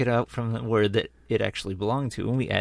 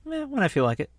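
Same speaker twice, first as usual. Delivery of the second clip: lazy shrug; 8 semitones higher, faster than usual. Pretend. Acting out a lazy partner.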